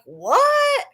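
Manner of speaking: a mock shocked squeak of a what; stunned what. Retold outraged question to him.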